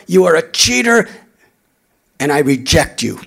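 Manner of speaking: loud, high scornful tirade; angry attack. Retold or imitated.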